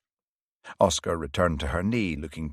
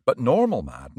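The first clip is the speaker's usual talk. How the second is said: plain reading voice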